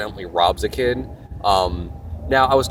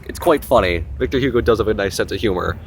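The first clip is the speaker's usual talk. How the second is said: ordinary delivery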